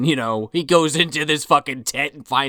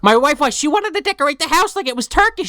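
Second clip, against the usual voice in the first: much higher, louder rambling narrator; high mock narrator voice; 4 dB louder than usual. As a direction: squeak out the tale as the mad narrator. Mock straitjacket narrator continues.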